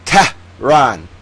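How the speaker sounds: Tehran drawn out slowly, "TEH... rahn"; slow, needling "TEH... rahn"; mocking over-pronunciation. Shown as a demonstration. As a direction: hammer "Tehran" as two slow separate syllables. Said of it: "again, Tehran".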